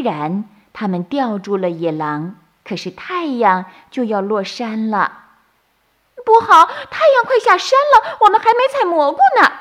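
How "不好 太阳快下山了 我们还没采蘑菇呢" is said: very high, loud, fast rabbit voice; alarmed bunny. A narrator's reading. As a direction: panic about the setting sun. (小兔惊慌尖叫)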